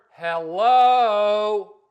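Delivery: very loud, stretched-out hello; booming sarcastic shout; mock obvious. Real, not acted. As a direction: bellow it, holding the last vowel. Mock-obvious exclamation, loudest.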